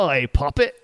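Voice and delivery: mock Cockney robot hail; cheeky fake-Cockney call; Cockney droid. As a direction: ham up a Cockney accent. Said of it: "it would be like the Cockney droid".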